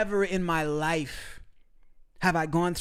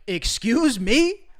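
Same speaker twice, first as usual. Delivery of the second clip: slow, louder, higher indignant excuse me; mock offended shock; 5 semitones higher, 5 dB louder, much slower than usual. Boyfriend stunned by girlfriend's blunt comment, slow offended disbelief. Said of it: "I'm like".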